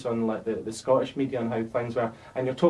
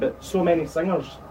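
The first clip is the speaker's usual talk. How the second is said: normal talking voice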